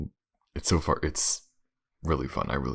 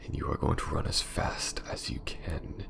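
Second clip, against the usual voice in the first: menacing vampire lady. Acted. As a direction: purr each threat like a cruel castle mistress savouring the hunt. Villainess threatens her prey, whispering.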